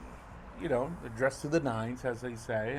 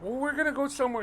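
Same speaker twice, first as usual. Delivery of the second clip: hurried reply; 13 semitones higher, faster than usual. Retold or imitated. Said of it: "I'm like".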